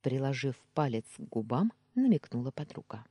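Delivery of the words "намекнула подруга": ordinary delivery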